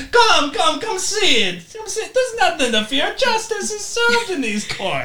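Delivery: high-pitched beckoning; coaxing call. Acted. Lawyer calling a frozen client into court, high coaxing voice. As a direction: coax the client inside in a high voice.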